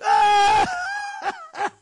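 loud, high-pitched hammed-up guffaw; bursting out laughing. Soldier hears the prisoner's dream, bursting out laughing. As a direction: explode into a long, squealing mock laugh. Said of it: "מתפרץ מצחוק".

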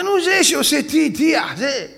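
high, loud, slow struggle for the word; groping for the word. Retold or imitated. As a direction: shout out trying to recall the name. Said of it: "אומר". (חיפוש מילה בקול גבוה ורם)

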